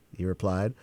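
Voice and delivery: ordinary delivery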